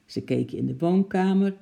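ordinary delivery

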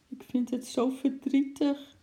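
put-on high, tearful child voice; trembling-lip sadness. A narrator's reading. Girl confessing sadness to her mother, trembling lip. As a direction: say it high, close to tears. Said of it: "zei Lotje met een trillend lipje".

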